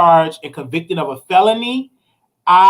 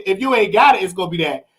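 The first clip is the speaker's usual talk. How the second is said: ordinary delivery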